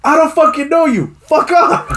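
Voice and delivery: very high mock shout; imitated yelling cop. Cop rejecting a stranger, mock yelling. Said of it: "the cop was yelling at him".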